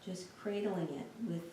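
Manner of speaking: soft, unhurried meditation voice with long pauses; guided meditation. Real, not acted. Teacher guiding listeners through a lying-down body scan, calm meditation voice. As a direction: guide them gently through a body scan, leaving space between phrases.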